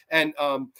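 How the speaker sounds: ordinary talk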